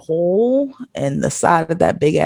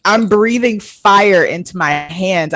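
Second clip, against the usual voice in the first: ordinary talk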